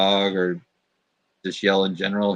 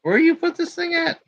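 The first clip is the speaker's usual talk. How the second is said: much higher, strange dog voice; a squeaky, searching dog; voicing the dog. Dog asking where the toilet paper went, very high squeak. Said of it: "She's like".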